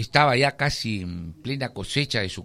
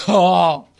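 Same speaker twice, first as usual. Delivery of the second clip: high startled cry; acted fright; frightened farmer; 4 semitones higher, 5 dB louder than usual. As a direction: react with a sudden scare. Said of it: "se asusta, pues, el, el señor".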